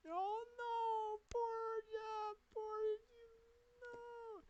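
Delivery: very high, hammed-up lament; keen in fake sorrow on a high held pitch; mock crying. Acting out feigned grief before the friends, mock crying. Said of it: "falls on the floor and starts crying".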